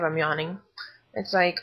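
usual speaking voice

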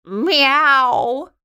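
high, loud drawn-out meow; cat meow. A narrator's reading. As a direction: mew like a cat. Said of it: "said Snoopy".

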